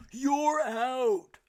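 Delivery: loud, high umpire shout; umpire's call. A narrator's reading. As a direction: bellow the call across the field. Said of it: "cried the umpire".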